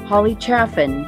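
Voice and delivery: normal talking voice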